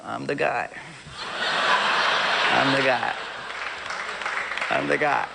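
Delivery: low words broken by laughter; low, laughing admission. Real, not acted. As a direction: laugh through it.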